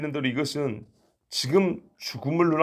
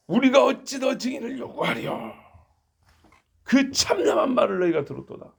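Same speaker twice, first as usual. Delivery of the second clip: much higher, theatrical outraged cry; exaggerated acting; 7 semitones higher than usual. High priest tearing his robe, hammed-up outrage. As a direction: overplay the outrage for the crowd. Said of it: "연기를 좀 과장되게 하면".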